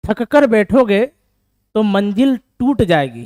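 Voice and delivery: loud, slow recital of a motivational line; reciting a couplet. Shown as a demonstration. Teacher declaims a motivational couplet to tired students, loud and slow. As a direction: declaim it slowly and loudly to the class. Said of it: "उस बात पे एक line है ना".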